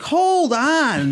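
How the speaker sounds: high, stretched-out "hold on"; alarmed retold protest. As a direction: act out a startled plea to stop. Calming man who pulls a knife.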